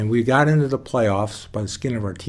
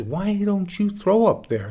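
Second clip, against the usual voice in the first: coach calling out; 10 semitones higher, 4 dB louder, slower than usual. Retold or imitated. Coach calls out to him.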